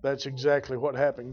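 normal talking voice

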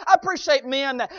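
ordinary talk, nothing marked

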